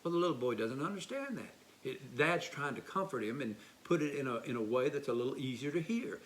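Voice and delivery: usual speaking voice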